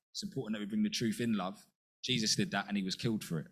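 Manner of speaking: ordinary talk, nothing marked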